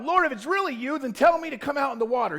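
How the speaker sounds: high, loud call across the water. A narrator's reading. Peter calls out to Jesus from the boat, high and loud. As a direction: call out to him over the waves. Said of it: "Peter called out".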